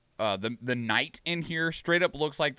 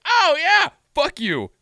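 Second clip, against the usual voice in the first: higher, louder, half-laughing fuck you; mock angry outburst; 12 semitones higher, 6 dB louder, slower than usual. Remembering the game he ranted about, mock angry outburst. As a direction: jokingly yell it at the developers. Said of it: "I was like".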